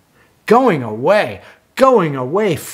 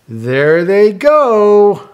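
slow, louder excited call; excited drawn out; 5 semitones higher, 6 dB louder, slower than usual. Acted. Reading to child as dogs drive away, excited. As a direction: cheer them off. Cars racing off.

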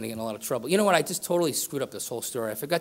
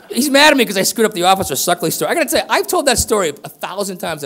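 raised voice, playful teasing; loud joking; 5 semitones higher, 10 dB louder than usual. Real, not acted. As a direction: call it out to the room, laughing at yourself. Joking loudly at audience member.

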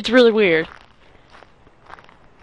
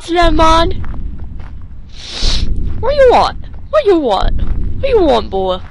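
tough guy voice; 8 semitones higher than usual. Pretend. A gamer taunting Slenderman in a hammed-up tough voice. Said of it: "I should not be talking like this".

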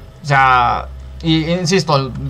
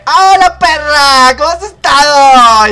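high, loud, drawn-out effeminate yell; shouted camp greeting; 10 semitones higher, 8 dB louder, slower than usual. Acted. Gamer re-enacting the greeting heard in the metro, flamboyant shout. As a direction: belt out a flamboyant hello, stretching the ending. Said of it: "hablando de esa manera".

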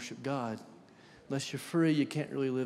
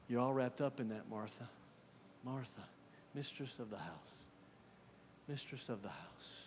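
much softer, tender naming of Martha; gentle, hushed address; 4 semitones lower, 13 dB quieter than usual. Acted. Jesus gently calls Martha mistress of the house, softly. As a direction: speak to her softly, as a friend who sees her.

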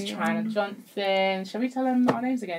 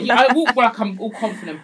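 ordinary talk, nothing marked

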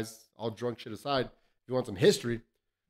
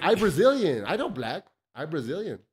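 a hint of the high [name] accent, louder; higher [name] accent impression, lightly done; 4 semitones higher, 4 dB louder than usual. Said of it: "[name] accent".

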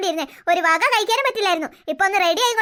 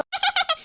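giggle; 9 semitones higher than usual. Acted. Sisters plotting a ghost prank.

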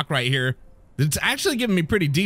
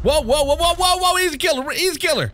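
frantic whoa; 12 semitones higher than usual. Real, not acted. Fending off attacker.

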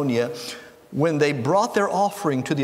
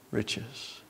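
dropped to a whisper; 7 semitones lower, 14 dB quieter than usual. Acted. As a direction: whisper riches with a slight rise. Repeating the verse, ending word.

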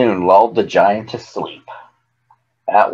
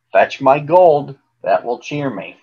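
plain reading voice

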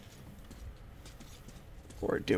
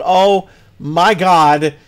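phobic panic; 4 semitones higher, 9 dB louder, much slower than usual. Real, not acted. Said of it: "of an arachnophobia".

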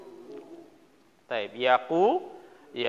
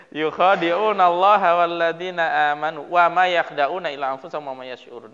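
measured, chanted Quran recitation; 4 semitones higher, 6 dB louder than usual. Acted. Recitation repeated.

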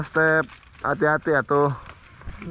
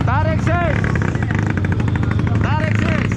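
high, sustained cheer; high-pitched rallying call; 11 semitones higher, 4 dB louder than usual. Real, not acted. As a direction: holler it over the engine noise. Calling out over engine noise.

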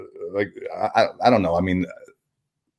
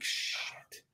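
whispered dismay; 20 dB quieter than usual. Retold or imitated. Learns parents must sign him in.

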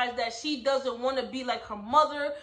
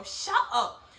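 high mock "shut up"; mock snap; 7 semitones higher than usual. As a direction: tell her mom to shut up, playfully. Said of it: "that's what January should have told her mom".

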